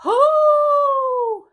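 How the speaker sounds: high loud hoot; owl hoot. Shown as a demonstration. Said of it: "Let me hear you hoot".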